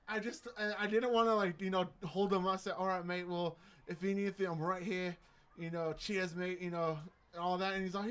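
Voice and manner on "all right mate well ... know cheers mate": casual, matey British sign-off; British accent. Acted. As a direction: wrap up in a put-on British accent. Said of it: "I can do a decent British accent".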